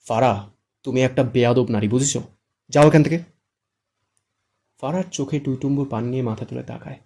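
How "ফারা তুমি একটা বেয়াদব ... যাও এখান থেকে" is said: building to a loud shout; scold, then shout her away. A narrator's reading. Shuddho shouts Fara away, building louder. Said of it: "আবারও ধমকে বলে".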